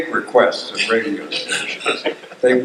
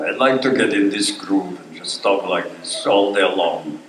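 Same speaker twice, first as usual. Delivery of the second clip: lower-pitched Russian accent; 5 semitones lower than usual. Shown as a demonstration. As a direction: settle into a playful Russian accent. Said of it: "I do enjoy doing Russian".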